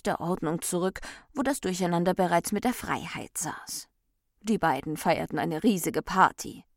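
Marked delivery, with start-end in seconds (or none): none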